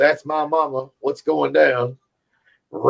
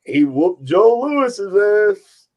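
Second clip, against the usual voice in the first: old barber impression; 8 semitones higher, slower than usual. Barber argument continues.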